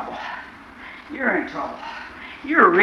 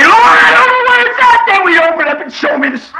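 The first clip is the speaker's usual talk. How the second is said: full-force preaching; 8 dB louder than usual. Acted. Preacher crying out to the congregation about riches. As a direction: lift them up, crying it out.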